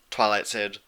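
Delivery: plain reading voice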